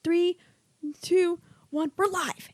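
lightly mimicked studio countdown; a hint of a TV crew cue; news countdown. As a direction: count in like a floor manager going live. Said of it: "I feel like I'm on the news".

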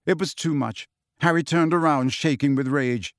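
ordinary delivery

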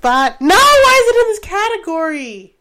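loud, high indignant protest; outraged. Real, not acted. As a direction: demand it, outraged. Outraged at song category.

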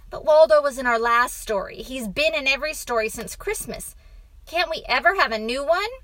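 fast, fairly loud complaint; exasperated father. A narrator's reading. Father objects to Waldo's return, exasperated. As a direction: hurry out a protest.

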